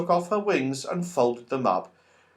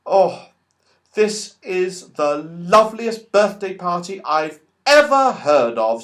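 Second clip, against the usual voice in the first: happy; 5 semitones higher, 5 dB louder than usual. Acted. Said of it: "said Beth happily".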